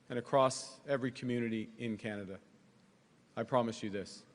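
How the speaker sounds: normal talking voice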